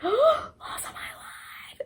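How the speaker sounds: Loss of my life whispered high and slow; a stunned, hushed whisper of Loss of my life; awed whisper. Real, not acted. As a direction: breathe it out in disbelief after a gasp.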